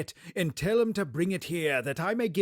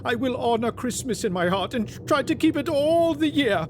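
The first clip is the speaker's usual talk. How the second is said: building to a high, loud anguished cry; desperate Scrooge; 10 semitones higher, 6 dB louder than usual. Acted. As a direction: plead ever harder until it breaks. Scrooge's desperate final prayer.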